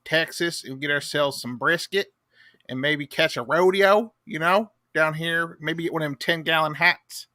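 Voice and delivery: lightly voiced good-ol'-boy Texan; put-on Texas accent. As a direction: play a folksy Texan dad. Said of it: "in that voice".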